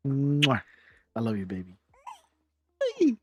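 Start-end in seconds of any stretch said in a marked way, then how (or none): 0.1-1.8 blown kiss then soft I love you; between kisses. Real, not acted. Kissing daughter goodbye.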